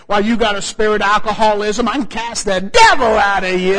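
lightly hammed-up judgmental believer casting out demons. Pushy Christians pouncing on sinner.

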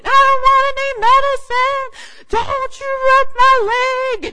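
high, loud tantrum yell; child screaming. Retold or imitated. Daughter refusing medicine from mom, high screaming tantrum. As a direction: scream it like a half-asleep kid refusing help. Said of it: "and she's screaming".

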